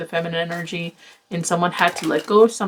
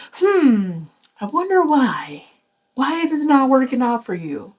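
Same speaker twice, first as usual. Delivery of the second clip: high, slow mock puzzlement; sarcastic wondering; 5 semitones higher, slower than usual. Tarot reader mocking repeat mistakes, sarcastic high wonder. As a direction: pretend to wonder, dripping sarcasm.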